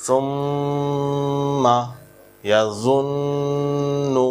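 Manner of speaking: ইয়াজুন্নু with the ন্ন held long through the nose; nasal hold demo. Shown as a demonstration. Teacher demonstrating ghunnah on the doubled nun to students. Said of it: "এই এক আলিফ গুণনা করাটা আপনার জন্য ওয়াজিব".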